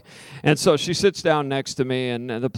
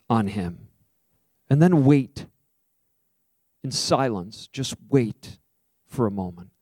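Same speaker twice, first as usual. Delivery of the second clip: gentle prayer guidance; slower than usual. Acted. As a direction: ease the room into silence. Guiding silent prayer.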